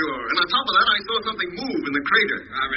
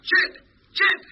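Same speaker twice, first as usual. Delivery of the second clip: higher excited call; 10 semitones higher than usual. Acted. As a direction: shout across to Jet. Lemmy calls out to Jet.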